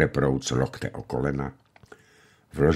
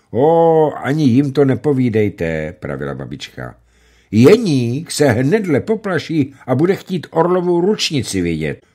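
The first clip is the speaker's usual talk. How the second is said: loud, much higher fussing plea; grandmother protesting; 8 semitones higher, 8 dB louder than usual. A narrator's reading. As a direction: fuss like a worried grandmother. Babička protests to the hunter.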